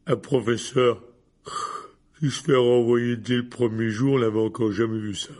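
put-on loud, thick drunken speech; slurred drunk voice. A narrator's reading. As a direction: ramble like a drunk giant. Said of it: "dit-il d'une voix pâteuse".

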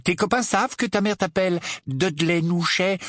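high, needling question; taunting. Acted. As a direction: goad your cousin with his baby name. Harry taunts his cousin. (question railleuse, voix haut perchée)

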